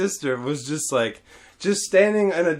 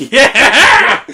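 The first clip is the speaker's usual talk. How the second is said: loud, high, laughing whoop; mock gleeful cheer; 8 semitones higher, 11 dB louder than usual. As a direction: egg on the chaos with a big yell. Retold cheering on the chaos.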